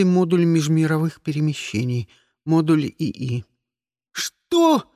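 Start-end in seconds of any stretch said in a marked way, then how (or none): 4.1-4.9 high anguished howl. A narrator's reading. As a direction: wail it out in dismay. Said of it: "взвыл я".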